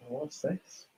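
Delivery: soft, curious what's this with a rise; hushed puzzled question. Real, not acted. Streamer spotting something odd on screen, asking softly. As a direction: ask what's this quietly, half to yourself.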